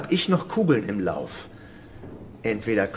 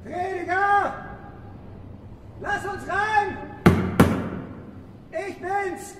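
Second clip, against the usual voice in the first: calling out; slower than usual. Acted. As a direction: shout to be let in. Calling out at the church door.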